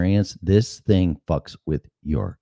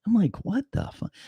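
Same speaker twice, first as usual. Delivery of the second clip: stunned; 5 dB quieter than usual. Retold or imitated. Shocked reaction retold.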